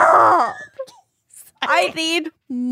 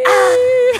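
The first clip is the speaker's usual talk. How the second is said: mock gasp; 10 semitones higher than usual. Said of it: "And she goes".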